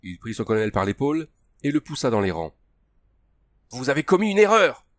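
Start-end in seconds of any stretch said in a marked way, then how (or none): 3.7-4.9 high, loud warning; raised, urgent shout. A narrator's reading. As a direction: cry out over the clamour. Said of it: "cria Émile".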